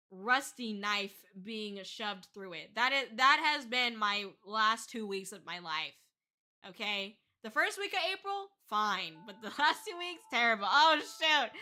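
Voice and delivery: ordinary talk, nothing marked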